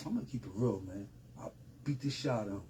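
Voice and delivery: very soft, low, quick admission; retold low-key confession. Inmate confessing to the officer at the gate, hushed and low. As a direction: own up quietly, as if off the record.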